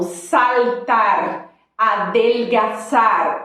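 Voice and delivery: saltar and adelgazar said slowly with a clear L; clear Spanish L. Shown as a demonstration. Teacher models the Spanish L slowly for students. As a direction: press the tongue up for the L in saltar, adelgazar. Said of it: "a língua tem que vir para o céu da boca".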